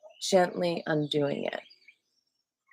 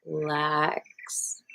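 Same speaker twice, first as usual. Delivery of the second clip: usual speaking voice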